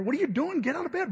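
high-pitched, swooping urgent nagging; urgent wife. Retold or imitated. Wife rousing husband in retold scene.

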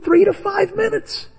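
high, incredulous inner voice; high mock exasperation; exasperated thought. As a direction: ask it in disbelief. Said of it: "And I'm thinking".

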